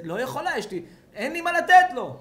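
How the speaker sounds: high, loud imitated protest; mock exasperated wife. Wife telling husband she cannot feed baby, mock exasperation. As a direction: wail the excuse in mock desperation.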